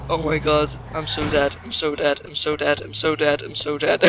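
resigned quiet. Real, not acted. Resigned mutter.

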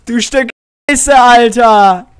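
loud, slow outraged swearing; shouted curse. Real, not acted. Player curses the monster that scared him, loud. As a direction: yell at the game after the scare. Cursing the game after the scare.

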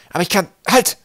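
high, loud interruption; calling out. A narrator's reading. Searching boy interrupts himself as he finds it, calling out. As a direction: break into an excited call. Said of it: "rief er".

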